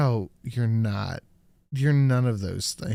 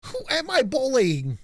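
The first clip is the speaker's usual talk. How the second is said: higher, louder mimicry of a politician; mock indignant question, pitched up; Kamala impression; 10 semitones higher, 6 dB louder than usual. Co-host parrots the clip's question, mock politician voice. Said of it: "she's doing the Kamala".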